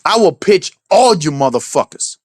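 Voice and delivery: ordinary delivery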